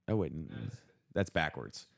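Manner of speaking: normal talking voice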